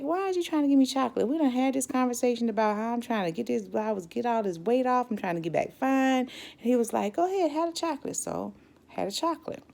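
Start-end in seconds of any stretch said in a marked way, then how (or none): 0.0-6.2 indignant retort. Retold or imitated. Her own outraged reply to doctor. 7.1-8.2 coaxing doctor. Retold or imitated. Doctor voiced pushing chocolate.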